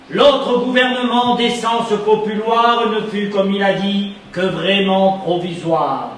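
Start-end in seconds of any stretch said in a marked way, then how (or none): none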